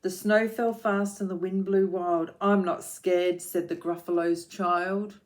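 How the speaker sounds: put-on rhythmic read-aloud for children; lilting picture-book reading; story narration.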